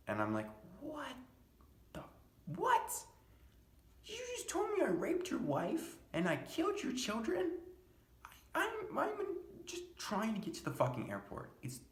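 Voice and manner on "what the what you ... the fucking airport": high-pitched, incredulous protest; acted disbelief. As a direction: squeal it in baffled outrage. Acted outraged reply to absurd accusation.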